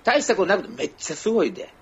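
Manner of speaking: mock Kansai bragging; exaggerated boast. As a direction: puff it up like it's huge news. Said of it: "大層に言うのね".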